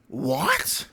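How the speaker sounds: high breathless gasp of a what; whispered squeak, jaw dropped; stunned disbelief. Real, not acted. Reacting to the manga explosion, stunned high whispered what.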